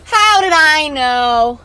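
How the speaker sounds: drawn out. Acted. Repeated question, first drawn out.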